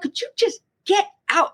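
high, indignant dismissal; exasperated reenacted demand; retold exasperated order. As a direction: act out throwing someone out, high-pitched. Ordering doctor out, acted out.

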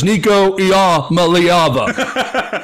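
Iamaleava drawn out syllable by syllable; slowed down. Retold or imitated. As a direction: mimic someone carefully spelling out Iamaleava by sound, very slowly. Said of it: "when he slows it down".

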